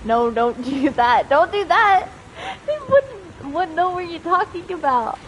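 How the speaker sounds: ordinary delivery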